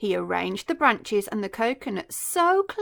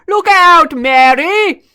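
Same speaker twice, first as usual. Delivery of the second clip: very loud, higher warning bellow; warning shout; 7 semitones higher, 16 dB louder than usual. A narrator's reading. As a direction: yell to save the child. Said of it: "shouted Humpy Rumpy".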